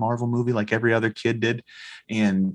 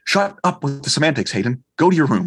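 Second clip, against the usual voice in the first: raised-pitch dad telling-off; mock scolding; 5 semitones higher, 3 dB louder than usual. Dad losing the argument with his son, comically stern. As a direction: send the kid away with comic force.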